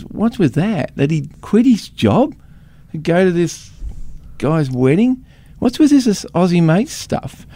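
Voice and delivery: mock bewildered American asking about mates; imitating American friend. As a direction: hold a high, baffled pitch through the whole question.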